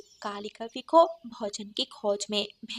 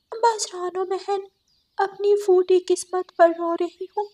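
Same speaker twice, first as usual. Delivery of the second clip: sorrowful crow; 9 semitones higher, 4 dB louder than usual. Acted. Kali tells the sparrow of her fate, sad and high. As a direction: mourn her bad luck, pitched high.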